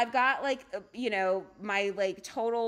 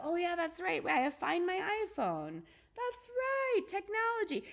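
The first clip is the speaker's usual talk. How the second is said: higher, bright-eyed oh yeah; suddenly remembering the fix, much higher; relieved realization; 8 semitones higher than usual. Retold or imitated. Sudden relief, remembering Find My iPhone.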